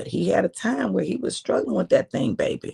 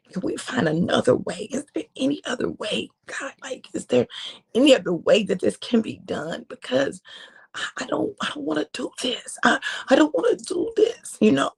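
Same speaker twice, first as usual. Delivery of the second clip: pleading; 6 semitones higher than usual. Acted. Jesus begging God in garden.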